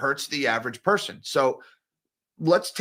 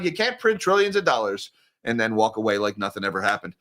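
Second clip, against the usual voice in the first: normal talking voice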